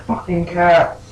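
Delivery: loud, high-pitched cursing at the cats; angry outburst. Real, not acted. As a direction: curse them out, voice jumping up loud. Player curses cat for shirt mess.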